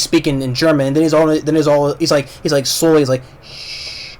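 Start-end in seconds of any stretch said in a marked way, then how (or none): 3.4-4.2 soft whispered shh, drawn out and calming; slow shushing. Retold or imitated. German soldier hushes the dying man, creepy whispered shush. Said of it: "the German is slowly shushing the guy".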